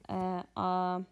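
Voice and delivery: normal talking voice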